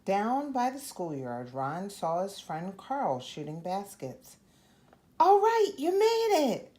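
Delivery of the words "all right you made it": much higher and louder cheerful call. Acted. Friend shooting baskets calls to arriving Ron, loud and high. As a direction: shout it across the schoolyard. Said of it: "called Carl".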